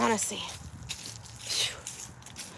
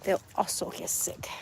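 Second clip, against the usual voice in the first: breathy whispered repeat for emphasis; 2 semitones lower, 6 dB quieter than usual. Shown as a demonstration. Whispering a warning to chicken keepers. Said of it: "repeated as a whisper".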